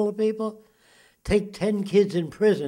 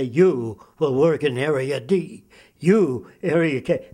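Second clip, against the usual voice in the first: slow, measured mock order-giver; technocrat giving orders; 2 semitones lower, 4 dB louder than usual. Imagined technical people assigning work areas, slow commanding voice. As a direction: assign people like a film dictator, slowly. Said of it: "technical people that say".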